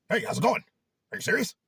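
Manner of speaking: a hint of the jovial boss greeting; imitating the boss. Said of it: "This is how he kinda is".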